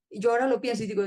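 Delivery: usual speaking voice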